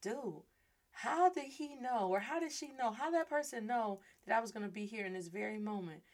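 softly voiced inner thought; thinking to oneself. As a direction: wonder it quietly to yourself, pitch lifting. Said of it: "you're thinking to yourself".

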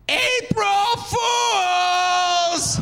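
high, long drawn-out shout; triumphant high-pitched holler; gleeful prank reveal. Retold or imitated. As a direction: sing out the reveal, stretching each word.

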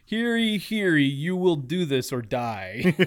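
loud, slow royal proclamation; town crier. Pretend. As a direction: bellow it like a medieval herald. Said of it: "Sixteenth century England or something like that, like castles and kings".

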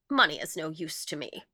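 usual speaking voice

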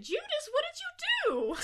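veel hogere, verbijsterde uitroep tegen Judas; high mock exasperation. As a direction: squeak it out at Judas, hammed-up dismay.

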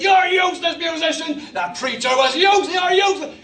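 high, hammed-up church heckling; mock insult-hurling. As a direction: throw insults in mock outrage. Mimics abusive church meeting.

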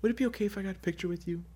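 soft, fast, gentle request; wife gently asking a star for a photo, softly. Retold or imitated. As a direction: ask politely and very softly. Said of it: "She goes".

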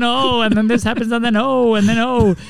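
high-pitched mock repeated ohs; imitating a friend. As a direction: mimic a flustered retelling up high. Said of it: "Jesse's just like".

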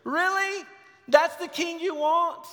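high, loud incredulous mock; laughing-at-them disbelief; God mocking Israel. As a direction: scoff at them from heaven. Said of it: "God is laughing his head off".

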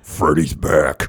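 low, slow, drawn-out horror-villain parody; mock horror voice. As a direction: announce the monster's return, low and stretched. Mock horror line repeating the joke.

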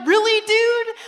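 loud, high, startled question; can't believe it; amazed disbelief. Retold or imitated. Friends question the healed teen, loud disbelief.

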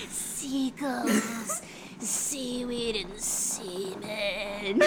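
slow, breaking into laughter; laughing. Real, not acted. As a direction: say it slow while laughter takes over. Repeating joke through laughter.

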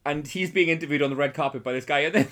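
normal talking voice

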